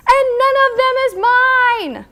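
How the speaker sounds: screaming. A narrator's reading. Angry Toad jumping up and down, screaming. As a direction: scream it in a rage. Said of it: "and screamed".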